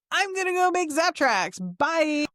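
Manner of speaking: high-pitched, teasing imitation; light, high mock announcement; mock-impulsive colleague. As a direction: imitate someone gleefully running off to a new project. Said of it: "he's off like".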